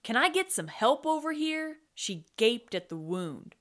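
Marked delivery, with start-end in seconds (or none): 0.0-1.8 high, loud call for help; hollering. A narrator's reading. Tootsie calling the crew to a wounded man, hollering. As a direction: call across the deck for help. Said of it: "Tootsie hollered".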